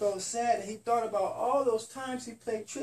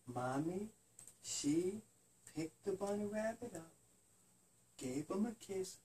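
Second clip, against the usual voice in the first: sleepy bedtime story; 5 semitones lower, 10 dB quieter than usual. A narrator's reading. Parent ending a bedtime story softly for a sleepy child.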